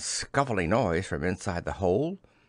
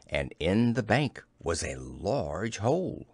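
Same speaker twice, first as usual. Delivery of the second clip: ordinary delivery, nothing marked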